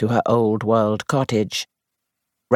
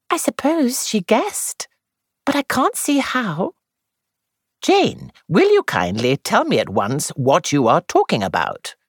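lightly voiced, higher young actress; young woman voice; 13 semitones higher than usual. A narrator's reading. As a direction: play the actress, higher, confiding. Jane voiced high.

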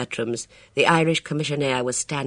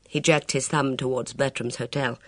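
ordinary delivery

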